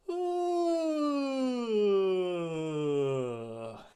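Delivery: loud, high stretching yawn; big yawn with a stretch; enthusiastic yawn. Shown as a demonstration. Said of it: "Yawn and stretch".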